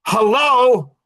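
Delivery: drawn-out, loud "hello", slow; sarcastic loud hello; loud mock hello. Real, not acted. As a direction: call out to wake him up. Calling out the dude.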